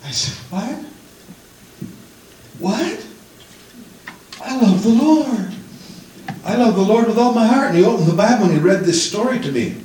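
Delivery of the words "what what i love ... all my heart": high, startled disbelief rising to protest; shocked protest. Retold or imitated. Young convert protesting to the older fellow, startled and high. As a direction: recoil in hurt surprise. Shocked reply to hurtful claim.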